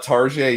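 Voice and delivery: Tarjay said the French way, tar-ZHAY, stress on the end; mock French Target. Repeats the mock French name.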